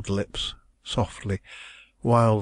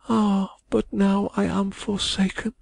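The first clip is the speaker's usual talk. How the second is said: high woman's voice, slow and hushed; forsaken murmur; 11 semitones higher than usual. A narrator's reading. Abandoned young woman murmuring to the lawyer, slow and high. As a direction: let the words sink in despair. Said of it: "she murmured".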